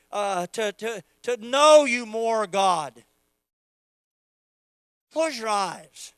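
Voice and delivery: loud, impassioned preacher's prayer; fervent prayer. Acted. Pastor praying aloud over the congregation, fervent and loud. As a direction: pray over the church with rising fervor.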